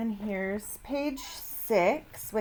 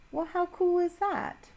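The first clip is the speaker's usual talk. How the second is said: high, delighted teacher question; amazed delight; 9 semitones higher than usual. Real, not acted. Teacher marvels at caption to class.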